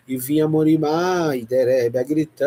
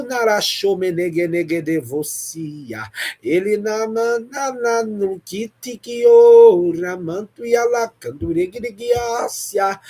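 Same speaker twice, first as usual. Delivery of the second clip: ecstatic tongues; 5 semitones higher, 3 dB louder than usual. Real, not acted. Intercessor praying for anxious souls, high ecstatic tongues. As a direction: climb into a sustained high outpouring.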